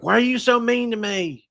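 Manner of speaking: high, hurt mock whine of the ex; ex-wife whining. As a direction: mimic her wounded high whine. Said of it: "And she's like".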